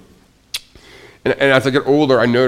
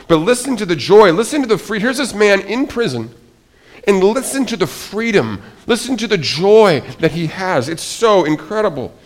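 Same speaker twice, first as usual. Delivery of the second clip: higher and louder, eager and amazed; excited wonder; 8 semitones higher, 5 dB louder than usual. Real, not acted. Preacher urges the congregation to hear Paul's joy, excited.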